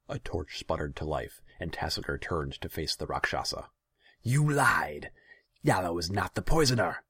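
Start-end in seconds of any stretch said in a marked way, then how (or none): 4.2-7.0 high, loud outburst of betrayal; outraged, raised-voice confrontation; furious accusation. A narrator's reading. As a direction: shout down the liar. Ruler confronts the demon.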